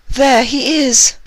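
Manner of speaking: very high, loud excited squeal; excited exclamation. Acted. Unicorn peeking through the curtain, high loud thrilled squeal. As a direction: squeal it out, thrilled to spot him. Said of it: "she said".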